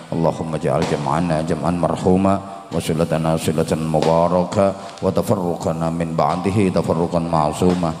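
low, steady Arabic prayer chant; prayer recitation. Real, not acted. Preacher closes the gathering with Arabic prayer, low recitation. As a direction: lead the congregation in supplication. Leads Al-Fatihah and closing prayer.

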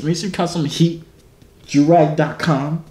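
ordinary talk

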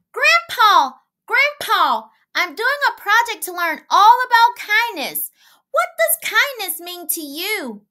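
put-on high, loud little girl calling Daddy; child calling out. A narrator's reading. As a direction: call out eagerly like a small girl.

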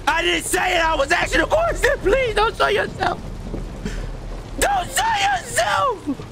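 high, shouted begging; panicked pleading. Real, not acted. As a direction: plead in terror for it to stay hidden. Begging the ghost not to appear.

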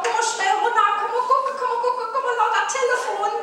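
high excited shouting up to the window, laughing; calling out. Retold or imitated. Calling the neighbour down to see the phone, shouting. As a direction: holler up to the neighbour to come down quick. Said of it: "ich stehe ja und ruf".

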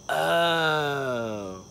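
long, sliding "ohhh"; drawn-out realizing "oh". Retold or imitated. Worker learning she has Alzheimer's, long dawning "oh". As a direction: stretch out one oh as it sinks in.

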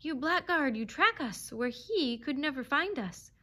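contemptuous accusation. A narrator's reading. Fox denouncing the tracking dog, hateful contempt. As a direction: let the hatred lift the pitch. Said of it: "taut with contempt and hatred".

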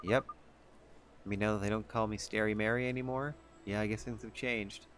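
ordinary talk, nothing marked

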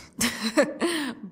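falling creepy laugh; sinister laugh. Acted. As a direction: laugh it off coldly. Villain laughing at captive.

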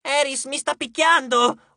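high, drawn-out complaint; squabbling cry of protest; yelling. A narrator's reading. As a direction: yell like a tattling sibling. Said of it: "urlò una voce".

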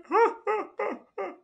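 high-pitched burst of laughter at his own pun. Real, not acted.

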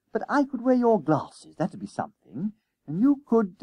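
put-on high-pitched boy planning as he goes; schoolboy Jennings thinking aloud. Jennings works out disguises for two runaways, eager, searching. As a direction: voice a boy improvising, trailing into hesitation.

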